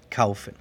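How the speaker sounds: "kaufen" with au as one gliding syllable; German diphthong. Shown as a demonstration. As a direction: say "kaufen" like "KOW-fen". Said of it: "Es A U". («kaufen» con el diptongo «au» alemán)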